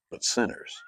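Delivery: hushed, breathy close to the line; whispered ending. Acted. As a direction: let the last words fall to a whisper. Quote ends on key word.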